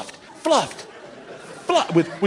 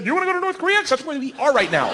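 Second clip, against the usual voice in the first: sarcastic jab; 10 semitones higher, faster than usual. Retold or imitated.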